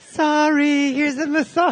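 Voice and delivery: mocking himself. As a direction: act a grudging apology in a silly voice. Mock-sulky [name] voice.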